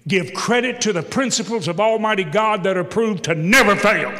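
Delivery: loud, rising sermon cry; full-force preaching. Acted. Pastor rallying the congregation, preaching at full force. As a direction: preach it hard to the back row.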